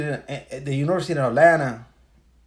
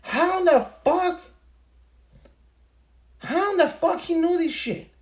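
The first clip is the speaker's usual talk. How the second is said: much higher, incredulous question; baffled disbelief; 14 semitones higher than usual. Retold or imitated. Recalling his amazement that the podcaster predicted the lockdowns, pitched high in disbelief. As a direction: ask it like you can't believe what just happened.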